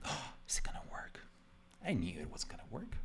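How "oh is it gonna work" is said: whispered worried viewer; hushed mock suspense; nervous viewer. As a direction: breathe it like an anxious fan. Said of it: "for you to be like".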